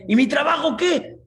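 high, loud, indignant demand; shouted protest. Acted. Man challenging Angel about his job, loud high protest. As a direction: yell the question in alarm.

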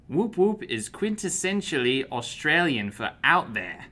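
attempted Australian accent, careful reading. Co-host reading the wine label on request, Australian accent. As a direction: read it out in an amateur Australian accent. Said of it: "read this in an Australian accent".

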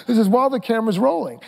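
high, quick, put-on weepy voice; mock crying while explaining; fake tearful voice. Shown as a demonstration. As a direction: talk through pretend sobs, high and rushed. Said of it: "I'm gonna cry".

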